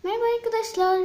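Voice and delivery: cheerful channel intro voice; bright high intro greeting. Shown as a demonstration. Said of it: "Tekrar giriş yapıyorum".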